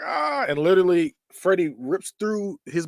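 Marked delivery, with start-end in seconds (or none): 0.1-0.5 short high mock shriek; imitated scream. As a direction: squeal in imitation of a victim. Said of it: "He's, like, screaming".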